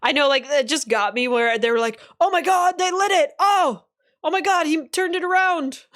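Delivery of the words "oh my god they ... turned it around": high, excited mock gasping reaction; imitating thrilled disbelief; astonished outcry. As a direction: act out amazed onlookers, pitched way up. Acting out astonished viewers' reaction.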